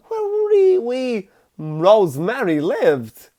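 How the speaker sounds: very high, lilting mock-Irish leprechaun; leprechaun Irish accent. Mocking the film's failed Irish accent, leprechaun Irish. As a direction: squeak it out in stage Irish. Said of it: "goes off to fucking Leprechaun Town".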